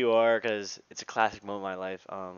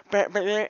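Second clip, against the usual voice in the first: high, loud mock bleating noises; three squawked syllables, mimicking the kid; imitated noise; 12 semitones higher, 12 dB louder than usual. Storyteller mimicking kid grabbing the phone, loud squawked noise. Kid punching in the emergency number.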